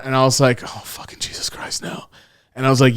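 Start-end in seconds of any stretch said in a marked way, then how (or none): 0.6-2.0 very quiet, voiceless aside; barely voiced dread to yourself; hushed, sinking inner panic; retold dread. Retold dread at being caught.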